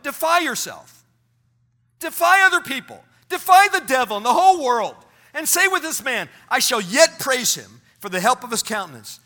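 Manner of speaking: loud, rousing crescendo of commands; defiant preaching. Acted. Pastor ending the quote on a great note, rousing defiance. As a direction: hurl it out defiantly. Climax of quote read aloud.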